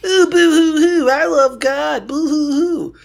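high fake sobbing boo hoo; mock crying. Mocking pious crier.

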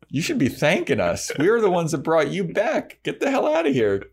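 high-pitched mock indignation, climbing as it goes; hammed-up scolding, pitch rising to the last dismissal; indignant retort. Vampire to ungrateful demon, mock indignant.

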